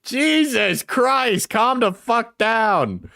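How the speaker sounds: high, slow, stretched-out exasperation; a high, slow Jesus Christ of disbelief; exasperated outburst. Real, not acted. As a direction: drag it out in disbelief. Mocking the angry voice memo.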